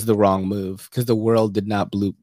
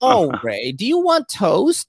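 lightly voiced wholesome sitcom mom, toast rising high and slow; a hint of an all-American TV mother offering breakfast; 9 semitones higher, slower than usual. Friend imitating a partner's parents as a sitcom mom, mild and hammed-up. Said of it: "Like, very Americana vibe".

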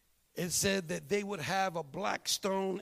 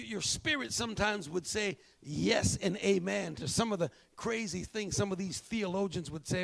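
ordinary talk

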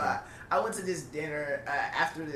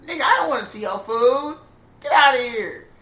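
loud, higher, disgusted; sudden loud outburst of disgust; 9 semitones higher, 12 dB louder than usual. Real, not acted. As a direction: blurt it out, fed up with the chewing. Ranting about friend chewing open-mouthed.

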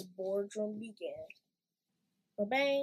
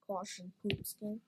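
usual speaking voice

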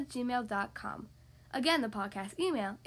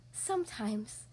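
a bit sad; 3 semitones lower, 4 dB quieter, slower than usual. A narrator's reading. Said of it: "Ariel replied a bit sadly".

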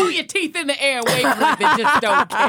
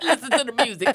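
usual speaking voice

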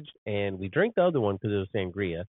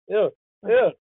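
normal talking voice

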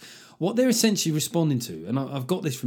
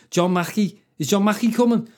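hint of Scouse; 8 semitones higher, 5 dB louder than usual. Shown as a demonstration. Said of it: "That sounds very Scouse".

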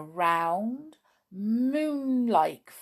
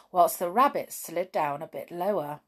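plain reading voice

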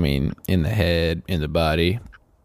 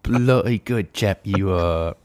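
mock British accent; slower than usual. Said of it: "we should start using just, like, English slang".

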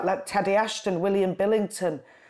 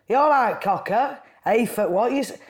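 Lancashire uncle; 3 semitones higher, 4 dB louder than usual. Retold or imitated. Imitating a Lancashire uncle greeting his niece with a louder Lancashire call. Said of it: "speaks in that Lancashire".

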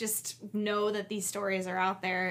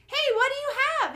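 high, quick, louder mock mom call; mom impression; 5 dB louder, faster than usual. Mom to little boy in the yard, high imitated call. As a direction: call out as the Vine's suspicious mom. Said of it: "and the mom's like".

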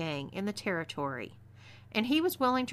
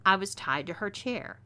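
ordinary delivery

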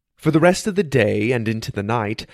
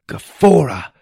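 higher, louder burst on a magic word; shouted spell; 4 semitones higher, 6 dB louder than usual. A narrator's reading. Apprentice casting a first wind spell at a bird, shouted. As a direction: call the incantation out with force. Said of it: "I shouted".

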